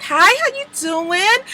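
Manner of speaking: high, put-on sugary voice, mocking Blair; fake sweet greeting. Mocking the fake friend's sugary high hello. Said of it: "she's all like this".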